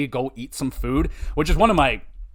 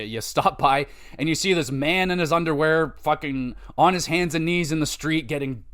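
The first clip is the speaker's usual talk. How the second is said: usual speaking voice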